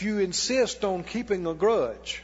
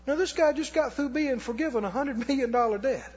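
ordinary talk, nothing marked

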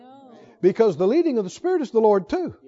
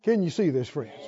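usual speaking voice

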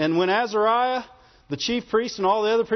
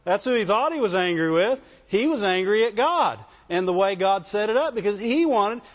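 usual speaking voice

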